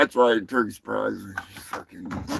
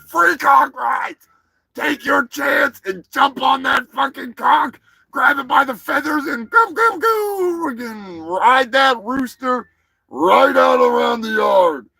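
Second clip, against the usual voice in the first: high, hammed-up hype shout; mock ad hollering; 9 semitones higher, 4 dB louder than usual. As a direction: holler it like a wild rodeo pitchman.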